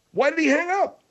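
very high, squealed rising demand; outraged question. Acted. Host demands why the caller hung up, high-pitched outrage. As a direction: send the whole question way up in disbelief.

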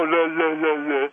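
mock singsong. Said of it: "what's happening is you go".

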